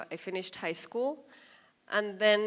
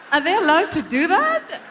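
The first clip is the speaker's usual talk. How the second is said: high, loud mock outrage, laughing; mock indignation; 14 semitones higher, 15 dB louder than usual. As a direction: play the offended diva.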